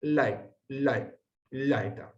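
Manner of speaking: lai, between I and E, then laeta; lai, lai, laeta, softly gliding; diphthong demo. Shown as a demonstration. Teacher modelling ae between I and E for the class. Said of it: "está entre una I y una E".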